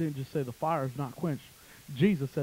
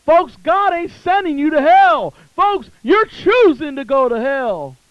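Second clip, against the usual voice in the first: rising, ever higher and louder hellfire sermon; fiery preaching; 10 semitones higher, 12 dB louder than usual. Acted. Preacher urges sinners to choose, building to a high, loud roar. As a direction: build to a roaring close. Altar call building to climax.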